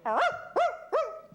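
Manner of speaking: high, slow drawn-out barks like a big dog; dog barking. Shown as a demonstration. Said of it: "I'll go".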